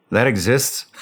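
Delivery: incredulous question that breaks into a laugh; a surprised laugh in disbelief. Retold or imitated. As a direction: laugh at how absurd it sounds. Disbelief at dart team.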